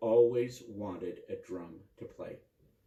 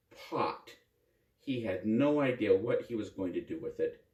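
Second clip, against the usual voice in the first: usual speaking voice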